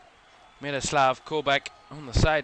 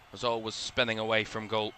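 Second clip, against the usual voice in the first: usual speaking voice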